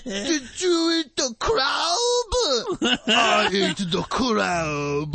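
put-on slow, high Japanese accent with laughter. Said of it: "he was like".

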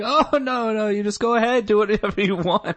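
high mock-permissive uncle voice; sarcastic uncle voice. Uncle pretends to be fine.

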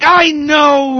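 high, drawn-out retold outburst; exasperated yell. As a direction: yell it back, fed up. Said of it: "Yelling isn't getting your point across".

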